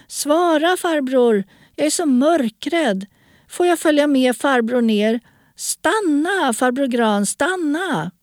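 put-on high child's voice, calling and begging; frightened pleading girl. A narrator's reading. Girl afraid of the dark follows him, high pleading calls. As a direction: call out high, begging for an answer.

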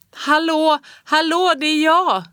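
loud high desperate calls; screaming. A narrator's reading. Child lost in snowstorm screams for help, loud and high. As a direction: yell it out so someone hears. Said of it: "skrek jag".

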